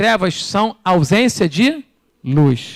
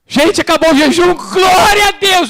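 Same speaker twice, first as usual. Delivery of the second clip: joyful shout; 8 semitones higher, slower than usual. Retold or imitated. Preacher acting a churchgoer celebrating the end of the fast, joyful shout.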